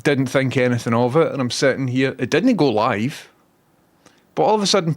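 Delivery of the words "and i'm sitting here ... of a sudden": normal talking voice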